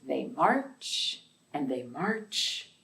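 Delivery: slow, gentle storybook reading; reading to children. Acted. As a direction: read it like bedtime storytime for little kids. Reads picture book to children.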